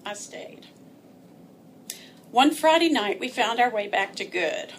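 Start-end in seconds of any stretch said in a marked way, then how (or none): 0.1-0.7 whispered resignation; quiet. Real, not acted. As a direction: breathe it out defeated. Resigned admission.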